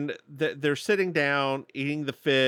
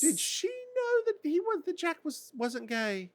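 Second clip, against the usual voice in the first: high put-on voice; 4 dB quieter than usual.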